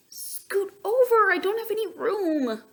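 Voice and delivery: a squashed duck griping; complaining Duck. A narrator's reading. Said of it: "complained Duck".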